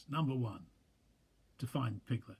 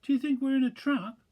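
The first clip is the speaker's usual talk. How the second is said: nervously; 14 semitones higher, 8 dB louder than usual. Acted. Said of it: "he went on nervously".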